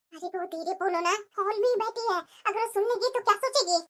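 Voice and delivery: low, hushed warning so the daughter won't hear. Acted. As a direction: keep it down, she's in the hall. Mother hushes daughter-in-law's complaint.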